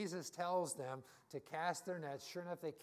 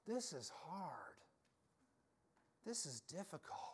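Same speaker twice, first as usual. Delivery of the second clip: weary lament; 7 dB quieter than usual. Retold or imitated. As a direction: sigh it out like someone worn down. Voicing believer's private struggle.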